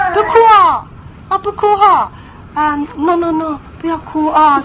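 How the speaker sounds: high, sing-song soothing; mock a too-gentle parent coaxing; soft coaxing. Preacher mocking soft parents soothing a tantrum, high coaxing. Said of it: "那你那时候如果说".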